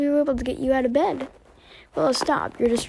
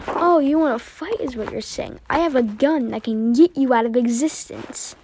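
ordinary delivery

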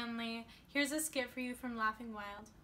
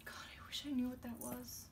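a hushed, puzzled whisper to herself; whispered aside; 6 dB quieter than usual. Acted. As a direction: whisper it so nobody hears.

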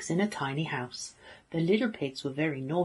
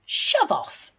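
high, loud piggy yell; rude shout; 13 semitones higher, 5 dB louder than usual. A narrator's reading. Pig to the wolf at the chicken shed, shouting rudely. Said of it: "shouted the pig rudely".

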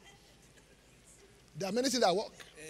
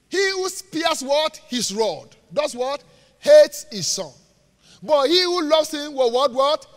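reads the verse loud and slow, pausing on what for the crowd; loud scripture call-out; 6 semitones higher, 10 dB louder, slower than usual. Acted. Preacher reading to the church, loud and drawn out. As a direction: drill the verse into the congregation.

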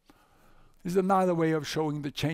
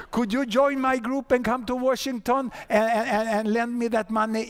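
eager pleading; 7 semitones higher, 3 dB louder than usual. Retold or imitated. Bush begs Lula to lend money.

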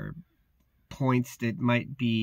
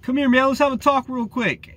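loud, high confrontational call-out; aggressive guard; 12 semitones higher, 7 dB louder than usual. Retold or imitated. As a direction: imitate a guard hollering someone over. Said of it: "to be aggressive instantly".

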